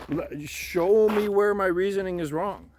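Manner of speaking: normal talking voice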